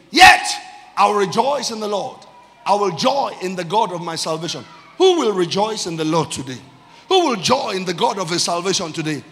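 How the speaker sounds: explosive first word, then ringing declaration; full-force preaching. Real, not acted. Preacher asking church who will rejoice today, full-force preaching. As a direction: challenge the congregation to join in.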